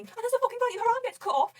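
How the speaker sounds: high, loud story climax; worked-up, high-pitched blurting; excited retelling. Real, not acted. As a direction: tell the shock moment at full pitch. Excitedly telling the fight's shocking moment.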